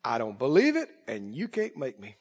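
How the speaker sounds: low, stubborn grumble; stubborn refusal. Acted. Preacher voicing the sign over resisters' heads, low stubborn refusal. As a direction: drop low and quiet, digging in. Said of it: "might as well be a sign over their head".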